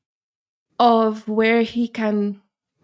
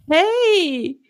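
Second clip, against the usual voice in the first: chirpy friend; 8 semitones higher, 4 dB louder than usual. Retold or imitated. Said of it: "she's like".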